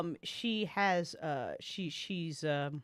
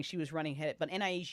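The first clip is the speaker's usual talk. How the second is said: normal talking voice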